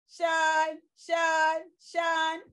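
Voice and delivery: Sean said as a clipped shun sound, repeated; tion sound shun. Shown as a demonstration. Teacher showing the class what tion says. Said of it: "T I O N says".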